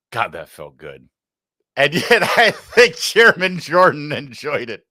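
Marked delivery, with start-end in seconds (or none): 1.8-4.9 chuckling through the words; laughing. Real, not acted. Host laughs at the hearing clip, amused chuckle.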